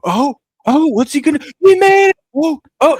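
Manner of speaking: high, loud hollering at the game; retold screaming. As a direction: scream along like kids cheering a close game. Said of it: "we were all screaming".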